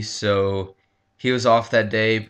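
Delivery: ordinary delivery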